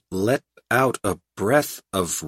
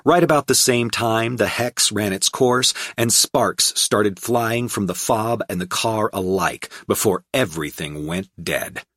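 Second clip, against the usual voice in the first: ordinary delivery, nothing marked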